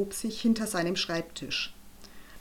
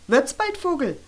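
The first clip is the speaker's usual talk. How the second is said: high, impatient hissed order; impatient teacher hurrying a pupil; hissing; 6 semitones higher, 5 dB louder than usual. A narrator's reading. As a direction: hiss it, pitched up and louder. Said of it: "Frau Galle zischte".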